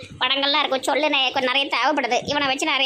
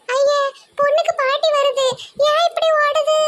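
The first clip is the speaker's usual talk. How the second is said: much higher, fussy feminine squeal; high mock woman's voice; 10 semitones higher than usual. Man playing a girl squeals at the grandma running past, high fussy voice. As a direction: pitch it way up like a silly girl.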